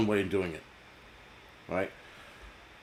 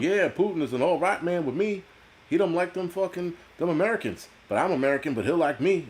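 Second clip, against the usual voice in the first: bad Alabama accent; 6 semitones higher than usual. Mocking an Alabama Putin fan, in a badly faked Alabama accent. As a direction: put on a mock Alabama accent. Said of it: "that wasn't an Alabama accent".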